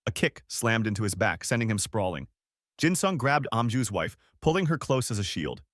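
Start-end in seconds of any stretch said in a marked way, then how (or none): none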